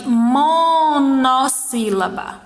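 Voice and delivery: MONOSSÍLABA with the mo held long, then nossílaba; stretched term. Shown as a demonstration. Teacher introducing a term, monossílaba stretched out slowly. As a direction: draw out the start of monossílaba. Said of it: "Uma sílaba, a gente chama de".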